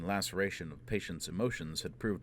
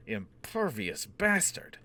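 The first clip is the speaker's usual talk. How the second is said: slow, louder angry curse; swearing; 3 semitones higher, 4 dB louder, much slower than usual. Acted. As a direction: spit out the curse in frustration. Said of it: "Dagenham swore".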